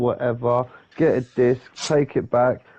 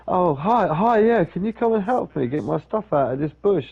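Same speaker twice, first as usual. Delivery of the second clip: higher, lightly voiced plea for help; imitated eager neighbour; 7 semitones higher than usual. Homeless neighbour asking teenager for help, imitated eager plea. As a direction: mimic his keen greeting and request.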